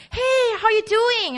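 high-pitched, chummy hello; friendly greeting. Acted. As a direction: hail a good friend casually. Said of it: "your friend came and".

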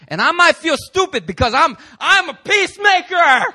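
high, loud, shouting preacher's cry; full-force preaching. Real, not acted. Pastor shouting faith declarations at the congregation. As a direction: preach it out with all your might.

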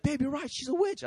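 excited wife. Acted. Wife confirming to husband he was right, excited high voice. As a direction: voice her high, breaking big news. Wife comes home with the news.

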